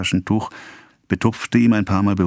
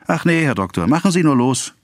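high, eager and a bit louder; farmhand's reply; 9 semitones higher, 4 dB louder than usual. A narrator's reading. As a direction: brush off the fear, cheerfully. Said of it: "erwiderte er".

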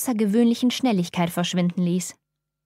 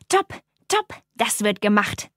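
loud shout. A narrator's reading. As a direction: shout the deal closed with glee. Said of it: "schrie er laut".

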